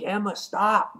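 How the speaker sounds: high-pitched mock plea to stop; imitated plea. Voices Cyclops pleading with Emma.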